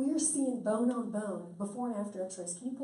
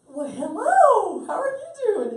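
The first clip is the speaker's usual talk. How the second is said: very high, slow, loud drawn-out hello; sing-song greeting; 11 semitones higher, 14 dB louder, slower than usual. Retold or imitated. Greeting the doubting woman in the restaurant, sing-song and very high. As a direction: sweetly tease her with an exaggerated hello.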